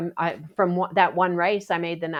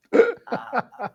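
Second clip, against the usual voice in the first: dumbfounded; 6 semitones higher than usual. Retold or imitated. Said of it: "He just sat there going".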